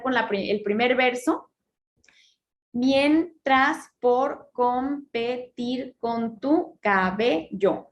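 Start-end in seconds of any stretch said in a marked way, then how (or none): 2.7-7.9 very slow, each syllable split apart. Shown as a demonstration. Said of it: "Son once sílabas". (separando sílabas muy despacio)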